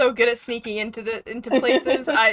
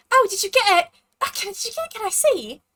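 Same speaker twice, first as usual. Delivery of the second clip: excited; 9 semitones higher, 3 dB louder, faster than usual. Acted. Lena eagerly asks to see amulet.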